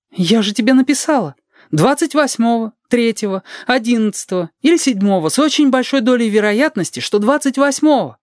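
high-pitched, insistent woman character; acted pedantic woman, pitched high; creaky-voiced Teresa. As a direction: remind them pointedly in a high character voice. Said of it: "Она говорит своим скрипучим голосом".

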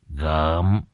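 plain reading voice

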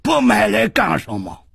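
high, indignant challenge; bullying demand. A narrator's reading. Bully threatens his frightened victim.

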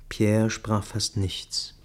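usual speaking voice

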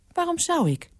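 very high, questioning refusal; high-pitched, reluctant housekeeper; balking housekeeper. A narrator's reading. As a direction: balk in a high woman's voice.

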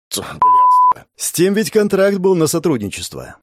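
sharp irritated outburst; annoyed curse. Real, not acted. Narrator curses suddenly.

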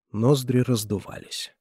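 plain reading voice

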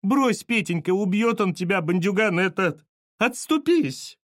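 high-pitched pleading lament; lamenting mother. A narrator's reading. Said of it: "запричитала маманя, перестав голосить".

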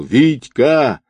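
desperate shout. A narrator's reading. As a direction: call out over dark water. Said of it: "отчаянно закричал".

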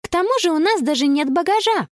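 put-on high, quick girlish chatter; breezy chiming in. Girl points out they have no luggage, high quick breezy. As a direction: add it lightly and fast. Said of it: "непринуждённо подхватила Конни".

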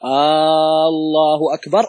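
"الله" with its opening hamza drawn out long and slow, like a question; slow demo of the forbidden lengthened hamza in "الله"; wrong long hamza. Shown as a demonstration. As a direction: stretch the first "آ" of "الله" slowly, as the mistake to avoid. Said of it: "عدم مد الهمزة في الله".

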